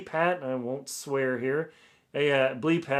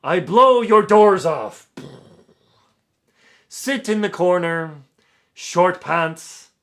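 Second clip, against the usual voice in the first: louder, higher, slow hammed-up pompous English accent; 4 semitones higher, 10 dB louder, slower than usual. Author reading the reviewer's boasts, pompous English accent. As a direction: boom it out like a grandiose English snob. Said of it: "pompous English accent".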